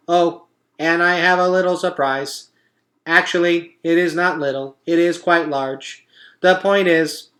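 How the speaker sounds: a cheerful machine making a speech; robot voice. A narrator's reading. Roz announcing the party truce to the animals, perky robot.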